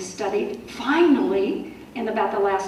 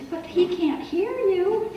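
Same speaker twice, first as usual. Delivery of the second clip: put-on high, drawn-out little-kid protest; singsong high child voice; small child; 9 semitones higher, slower than usual. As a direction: imitate a child correcting mom, slow and high. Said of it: "and she'd go".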